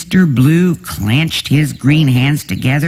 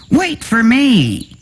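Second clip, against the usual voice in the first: calling out; 11 semitones higher than usual. A narrator's reading. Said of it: "Mister Red climbed down".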